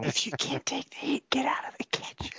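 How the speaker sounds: breathy whisper through laughter; laughing whisper. Real, not acted.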